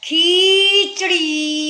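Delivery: "खीचड़ी" with both ee sounds drawn out; wrong-form demo, long vowels in "खीचड़ी"; long first ee. Shown as a demonstration. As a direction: deliberately stretch "खी" in "खीचड़ी". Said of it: "ख में भी बड़ी मात्रा".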